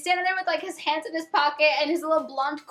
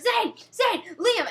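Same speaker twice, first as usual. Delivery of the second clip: calling out names; 10 semitones higher, slower than usual. Retold or imitated. A friend runs at the band, yelling their names. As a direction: call the names out like a fan chasing a car. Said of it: "she's, like, yelling their names".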